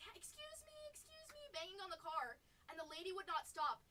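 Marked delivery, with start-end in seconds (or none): 0.0-1.4 high, thin calling out. Retold or imitated. Chasing the Uber and calling to the driver, high call. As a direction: chase after a car politely. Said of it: "I was like".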